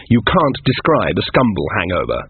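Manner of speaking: usual speaking voice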